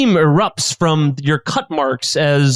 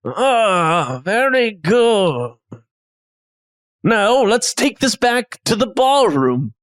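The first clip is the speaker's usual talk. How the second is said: put-on chef voice with stretched words; chef character; 4 semitones higher than usual. Chef questions, then approves.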